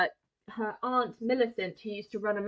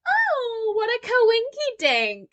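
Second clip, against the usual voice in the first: much higher, slow and sing-song, faking delight; a sugary mock coincidence, pitched way up; mocking sweet voice; 12 semitones higher, 5 dB louder, slower than usual. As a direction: play the gushing fake-nice girl. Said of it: "she was like".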